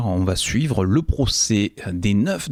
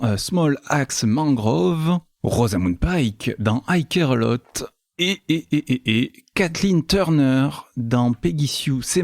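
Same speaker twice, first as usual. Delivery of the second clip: show announcer; 5 semitones higher than usual. Acted. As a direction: announce the line-up with swooping, stretched words. Host announces the programme. (annonce de présentateur, lente et chantante)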